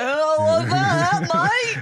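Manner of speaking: hammed-up shirtless Aussie with a gross beer; slow, coarse caricature Australian accent. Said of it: "like a gross Australian with no shirt on".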